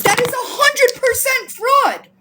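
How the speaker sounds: high-pitched, outraged verdict; indignant. Real, not acted. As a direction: declare it with indignant certainty. Indignant accusation of charity fraud.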